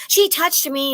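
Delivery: high, loud tattletale whine; childish tattling. Retold or imitated. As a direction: tattle like a wronged kid. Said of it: "My mom would be like".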